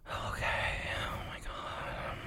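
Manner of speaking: breathless, whispered oh my God; out of breath. Real, not acted. Vlogger winded after a long rant, breathless whisper. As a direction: gasp it out with no air left. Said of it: "I'm, like, out of breath".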